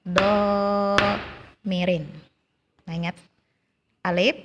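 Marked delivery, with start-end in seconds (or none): 0.0-2.0 domirin slowly, the i held for two beats; teacher models domirin with a long held vowel. Shown as a demonstration. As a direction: draw out domirin in recitation style. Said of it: "Sekali lagi".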